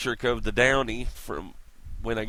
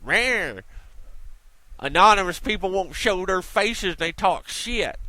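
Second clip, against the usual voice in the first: mocking Tavis; 6 semitones higher, slower than usual. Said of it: "He got on there and said".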